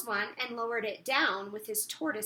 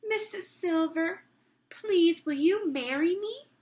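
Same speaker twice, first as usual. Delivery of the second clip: nervous proposal; 5 semitones higher, 5 dB louder than usual. Acted.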